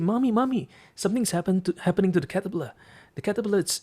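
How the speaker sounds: put-on high, urgent child voice; alarmed little boy. Little boy reporting the caterpillar's trouble to Mommy, high and urgent. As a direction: call out like a worried kid.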